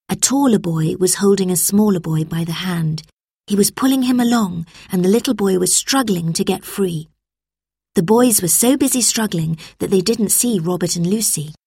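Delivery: plain reading voice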